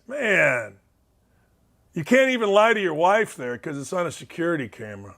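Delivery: normal talking voice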